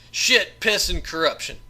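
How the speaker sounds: snarled curse, loud and high; angry outburst. Acted. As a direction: spit the curse out in fury. Assassin curses at George.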